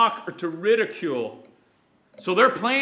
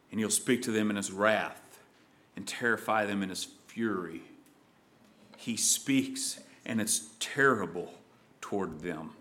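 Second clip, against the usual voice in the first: low, much softer, dark and grave; softer and lower, ominous; hushed and ominous; 9 semitones lower, 8 dB quieter than usual. Acted. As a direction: tell of the wrath in a low, soft voice. Describing God's wrath, hushed.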